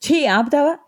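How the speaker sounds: high sharp shout to stop him; wife shouting at her drunk husband to stop. A narrator's reading. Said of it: "అని అరిచింది తన్మయి".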